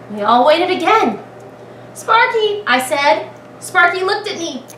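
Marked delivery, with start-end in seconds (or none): 1.9-2.6 high call of the pet's name. A narrator's reading. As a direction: call him to attention. Child calls the pet.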